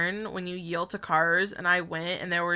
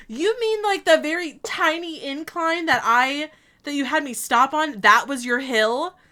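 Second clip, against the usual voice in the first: indignant disbelief; 6 semitones higher, 5 dB louder than usual. Retold or imitated. Retelling argument with driving examiner.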